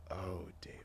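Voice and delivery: lightly voiced tender father calling his son's name; understated fatherly sigh of the name. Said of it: "Yeah, like, fatherly".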